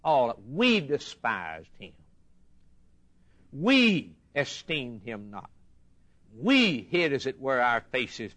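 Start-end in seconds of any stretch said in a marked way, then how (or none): 0.5-5.5 WE stretched slowly, then falling away; stress on WE. Shown as a demonstration. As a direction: lean slowly on each "We". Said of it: "The we and the our and the us".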